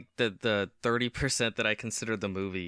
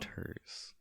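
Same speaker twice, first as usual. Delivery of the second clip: whispered; 13 dB quieter than usual. Pretend. Teasing a friend.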